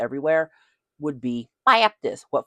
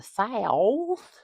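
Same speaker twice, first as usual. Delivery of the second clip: stretched-out, rising Southern drawl; 8 semitones higher than usual. Acted. Southerner setting up the North-South church story, playful drawl. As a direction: draw SOUTH out long like a Southerner.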